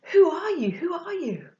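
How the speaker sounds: high, wondering onlookers; high-pitched mock amazement; amazed crowd. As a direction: imitate an awestruck crowd questioning. Crowds marvelling at John.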